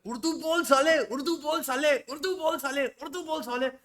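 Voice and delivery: high-pitched mock heckler chant; badgering commenter, hammed-up and high; mock heckling. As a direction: imitate a pestering heckler, pitched well up. Acting out a heckler's repeated comment.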